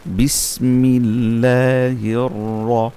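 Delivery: slow drawn-out Arabic recitation with a trilled র; Quranic recitation demo. Shown as a demonstration. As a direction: chant বিসমিল্লাহির slowly, tongue tip tapping on র. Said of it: "র জিহ্বার আগের উল্টা পিঠ". (ধীরে টেনে তিলাওয়াত)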